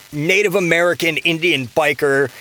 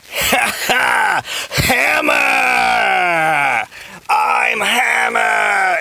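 high, hammed-up yell of Hammer mid-laugh; villain bellowing his own name while laughing; laughing shout of his name; 7 semitones higher, much slower than usual. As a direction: crow your own name like a cackling villain. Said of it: "terribly laughing and just going".

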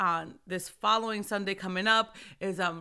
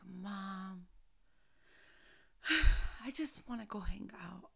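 put-on weary child; 7 dB quieter than usual. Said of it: "he's like".

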